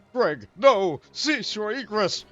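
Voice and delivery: loud, high dramatic shout. Acted. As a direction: yell after him theatrically. Calling after fleeing villain.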